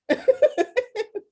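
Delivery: high burst of laughter. Real, not acted. Teacher laughing at a student's answer, high laugh.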